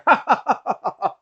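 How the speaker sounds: high, loud helpless cackle; shrill giggling fit; hypnotized cackle. Acted. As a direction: cackle like you can't stop. [name] cackling under hypnosis.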